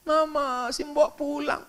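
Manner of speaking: put-on high, whiny little child calling mama; high child complaining to mother; child's voice. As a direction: play the upset little kid. Child crying as maid leaves.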